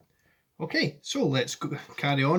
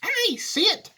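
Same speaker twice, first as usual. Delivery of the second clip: high, louder Wonka; Wonka cries out; 5 dB louder, slower than usual. Acted. Wonka seeing the cottage, high and louder. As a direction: spot it with delight. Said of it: "cried Mister Wonka".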